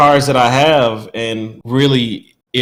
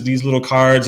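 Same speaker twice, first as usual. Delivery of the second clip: ordinary talk, nothing marked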